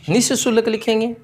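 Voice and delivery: ordinary delivery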